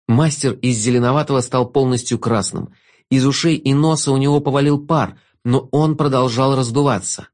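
plain reading voice

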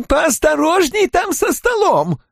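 very high-pitched character voice; shouting innkeeper. A narrator's reading. Innkeeper directs furniture rescue at fire, high-pitched shouting. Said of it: "кричал Куале".

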